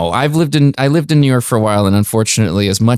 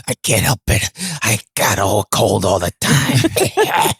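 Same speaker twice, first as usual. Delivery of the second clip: slow, stuffy sick voice; sick guy voice; slower than usual. Retold or imitated. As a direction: moan it like you're always ill. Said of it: "he's always got".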